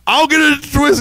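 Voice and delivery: high-pitched mock determination; retold stubborn insistence. Arguing with Chris over a stuck bulb, stubborn insistence.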